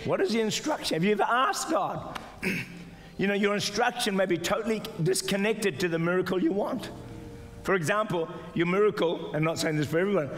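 ordinary delivery

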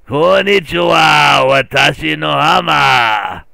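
slow, loud drawn-out Japanese shout; hammed-up Japanese. Streamer shows off Japanese in a slow hammed-up bellow. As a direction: bellow it, stretching every vowel.

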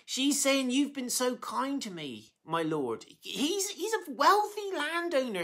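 real welling-up, pitch riding high; high, emotional voice near tears; getting teary. Real, not acted. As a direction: choke up as the story moves you. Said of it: "I'm getting teary already".

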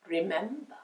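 lightly voiced cat, low and soft; understated ominous cat; warning cat. A narrator's reading. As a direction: warn quietly.

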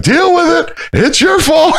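high mock shout; commander yelling. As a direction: yell it at the whole company. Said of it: "my company commander is like".

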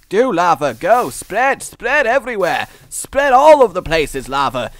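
hammed-up rallying cry, high and loud; rally the lava like troops; mock commands to lava.

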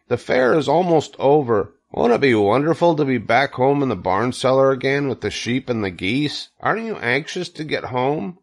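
a hint of an excited young character; eager Wilbur.

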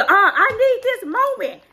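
high-pitched wailing mimicry; mock demanding outburst. Mimics castmate demanding her moment.

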